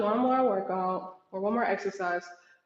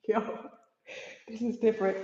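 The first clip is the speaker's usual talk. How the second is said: ordinary talk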